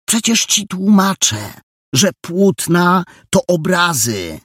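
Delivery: high, loud, slowed-down emphasis; exasperated explaining. Acted. Jola explains canvases mean paintings, exasperated. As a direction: spell it out slowly and loudly, out of patience.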